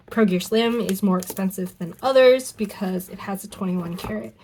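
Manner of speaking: ordinary talk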